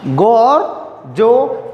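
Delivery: गो और जो spaced out very slowly; teacher stretches गो और जो very slowly; very slow demo. Shown as a demonstration. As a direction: spell out गो and जो slowly for students. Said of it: "जी का दो sound".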